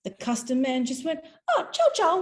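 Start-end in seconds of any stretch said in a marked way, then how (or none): 1.5-2.2 much higher, louder Oh, ciao, ciao; chirpy dismissal, way up high; mock customs man. Customs man waving her through.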